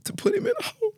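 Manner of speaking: high, breaking voice through tears; crying as she repeats it. Real, not acted. Mother recalling the social worker, crying high.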